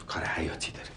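hushed mock-secret whisper; breathy conspiratorial whisper. Husband mocks the caller's urgency.

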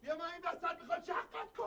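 a furious man threatening the neighbour; angry threat. Acted. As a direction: threaten him in a rage.

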